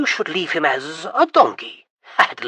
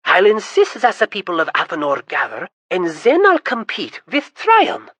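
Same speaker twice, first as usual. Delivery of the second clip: much higher, louder, gloating glee; gleeful scheming; 8 semitones higher, 8 dB louder than usual. Acted. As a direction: crow over the plan to humiliate Tryon. Prince gleefully plotting his triumph.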